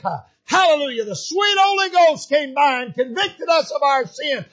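loud, driving pulpit delivery; fervent preaching. Acted. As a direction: preach it with mounting fervour to the congregation. Preacher erupts in hallelujah shout.

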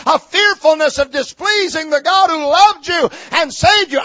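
high, shouted sermon delivery; full-force preaching. Real, not acted. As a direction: build to a loud pulpit shout.

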